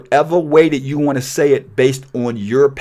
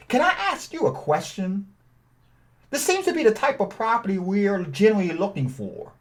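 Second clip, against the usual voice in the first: higher, softer seller-friendly ask; friendly caller script; 5 semitones higher, 7 dB quieter than usual. Shown as a demonstration. Buyer calling a seller, raised and gentle. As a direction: sound like a polite buyer on the phone. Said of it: "Or you can say like this".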